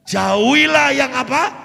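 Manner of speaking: slow, high, shouted cue; call-and-response prompt. Real, not acted. Preacher prompting the congregation to finish the verse. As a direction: draw it out and wait for them.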